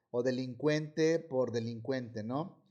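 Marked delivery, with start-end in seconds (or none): none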